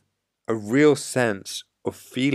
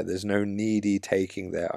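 ordinary talk

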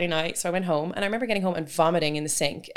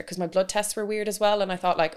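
ordinary talk, nothing marked

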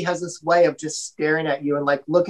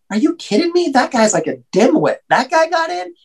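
incredulous; 10 semitones higher than usual. Retold or imitated. Telling the story of a dimwit getting in, high incredulous squeak. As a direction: pitch shooting up in disbelief.

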